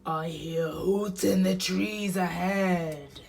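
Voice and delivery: slow, lightly roughened monster; listen out slowly as a monster; Gruffalo. Acted. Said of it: "till the Gruffalo said".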